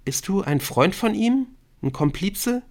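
very high, questioning character voice; high-pitched masked girl; Gollum girl. Acted. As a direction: question a stranger suspiciously in a high voice. Gollum girl speaks first time.

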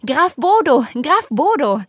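loud, high, overjoyed cry; excited shouting. A narrator's reading. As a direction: shout the name with glee. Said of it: "schrien die Eltern auf sie ein mit einer Begeisterung".

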